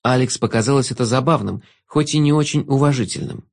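ordinary delivery

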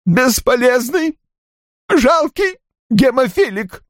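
very high, strained weeping voice with long breaks; talking through sobs. A narrator's reading. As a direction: force out broken phrases as if sobbing. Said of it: "заговорил сквозь всхлипы". (говорить сквозь всхлипы, высоким плачущим голосом)

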